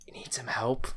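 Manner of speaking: whispered, unsettling offer of help; mock creepy whisper. Pretending to turn round to make them uncomfortable, whispered.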